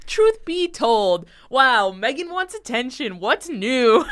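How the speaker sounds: loud, high, drawn-out self-mockery; mock confession. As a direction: announce it slowly at high pitch, making fun of yourself. Mock self-exposing outburst about wanting attention.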